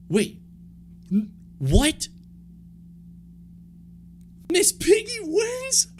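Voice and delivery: very high, slow, incredulous question; disbelief. Real, not acted. Host stunned that Miss Piggy wins, squeaky disbelief. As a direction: stretch it out in total disbelief. Shocked by unexpected winner.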